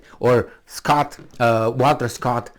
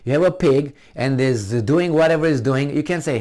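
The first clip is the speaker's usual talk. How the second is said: ordinary talk, nothing marked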